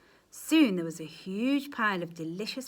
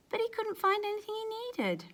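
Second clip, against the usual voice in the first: high voice; 13 semitones higher than usual. A narrator's reading. Sudden high narration line.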